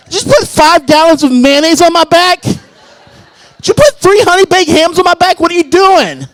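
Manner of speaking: high, loud outraged squeal; a woman's hammed-up squeal of complaint under his belly; crushed partner complaining. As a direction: protest being squashed under a huge weight. Partner complaining about belly weight.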